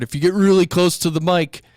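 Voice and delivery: booming up close, loud; right on the mic. Shown as a demonstration. Teacher demos being too close to the mic. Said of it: "it's hard to do it without a".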